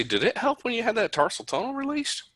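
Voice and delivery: a hint of a doctor quizzing his patient; asking the patient. Doctor asks a patient about past surgery, light high voice. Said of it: "I'll say".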